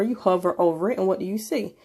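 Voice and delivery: normal talking voice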